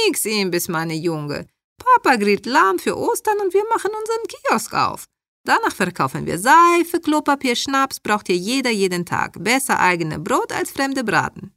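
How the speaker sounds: high-pitched, emphatic mother in broken German; immigrant mother figure. Acted. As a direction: play the mother overruling her child, voice swooping high.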